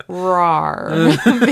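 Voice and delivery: loud, drawn-out mock roar; hammed-up raptor growl for laughs; playful dinosaur roar. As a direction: roar like a goofy velociraptor. Imitates a dinosaur roar, joking.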